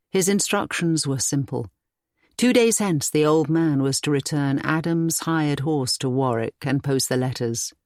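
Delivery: usual speaking voice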